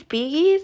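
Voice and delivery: "Bee Gees" as bee-GHEEZ, questioning rise; unsure hard G in "Bee Gees", pitch rising; hard-G pronunciation. Real, not acted. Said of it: "I don't know".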